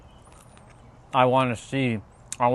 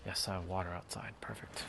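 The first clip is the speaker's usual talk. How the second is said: whisper; 4 semitones lower, 17 dB quieter than usual. Real, not acted. Whispered aside.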